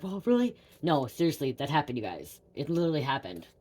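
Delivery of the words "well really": high, doubtful well really; mock disbelieving audience; pitched-up incredulous question; skeptical viewer. Imagined viewers doubting the story.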